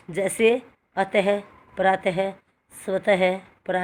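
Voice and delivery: अतः, प्रातः, स्वतः slowly, each ending in a breathy ह; visarga demo. Shown as a demonstration. Teacher shows the class the ह of visarga. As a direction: end अतः with an audible ह breath. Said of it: "ह की आवाज़ आ रही ना".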